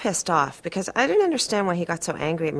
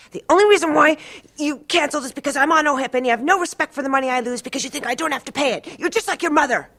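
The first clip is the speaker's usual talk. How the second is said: higher, louder, fast accusing tirade; angry accusation; 9 semitones higher, 4 dB louder, much faster than usual. Retold or imitated. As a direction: rattle off the blame at her. Therapist voiced in a quarrel.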